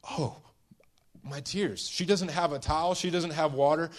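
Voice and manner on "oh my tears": soft, mildly tearful gasp of dismay; a touch of acted weeping, kept soft; acted crying. Weeping woman noticing her tears, mildly acted crying. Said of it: "And she's crying, and she's like".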